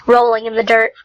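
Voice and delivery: ordinary delivery, nothing marked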